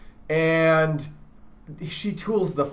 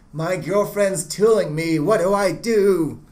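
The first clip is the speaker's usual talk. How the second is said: slow, higher, whining complaint; whiny student voice; 4 semitones higher, 4 dB louder than usual. Retold or imitated. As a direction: whine it like a helpless student. Mocking a student's typical complaint.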